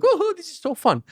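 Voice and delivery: put-on high, happy kid's voice; little daughter. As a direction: squeal it like a thrilled toddler.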